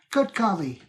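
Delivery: put-on British exclamation; British accent. Shown as a demonstration. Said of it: "I can do British accents".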